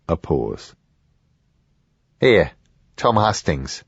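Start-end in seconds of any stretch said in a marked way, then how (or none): none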